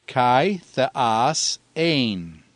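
kai theas ein, each word spoken slowly and separately as a classroom model; slow Greek reading demo of kai theas ein; Greek phrase read out. Shown as a demonstration. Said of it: "You see the grave on the kai".

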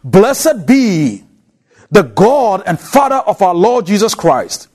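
normal talking voice

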